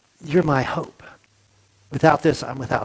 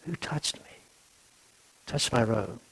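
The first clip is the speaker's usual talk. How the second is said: soft, tender whisper, much softer; in love; 4 semitones lower, 15 dB quieter than usual. Shown as a demonstration. Preacher demos Jesus asking lovingly in a hushed whisper. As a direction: breathe it gently, with love. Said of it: "he said it in love".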